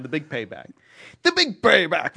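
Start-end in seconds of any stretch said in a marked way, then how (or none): 1.2-2.2 higher, louder funk shout. Acted. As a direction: belt it like a soul singer.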